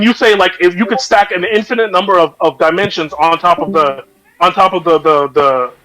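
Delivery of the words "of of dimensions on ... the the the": stumbling over repeated words; stuttering. Real, not acted. Debater losing the thread mid-argument, stuttering. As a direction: get stuck repeating the little words. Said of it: "I'm stuttering".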